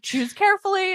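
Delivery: ominous warning. Retold or imitated. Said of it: "where you were like".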